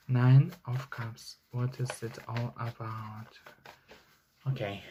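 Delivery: very soft, barely voiced asides; mumbling to herself. Real, not acted. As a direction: talk under the breath while thinking. Muttering to herself while reading.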